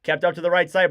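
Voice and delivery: ordinary talk, nothing marked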